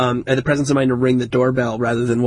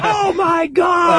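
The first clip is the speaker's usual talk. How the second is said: Chris Farley dad; much slower than usual. Retold or imitated. Dad opening the door to bleeding son, Chris Farley shout. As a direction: bellow it like a shocked Chris Farley. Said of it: "my dad was Chris Farley".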